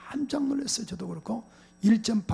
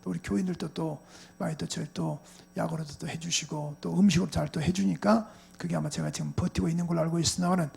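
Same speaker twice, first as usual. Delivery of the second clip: ordinary talk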